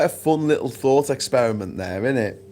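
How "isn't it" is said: ordinary talk, nothing marked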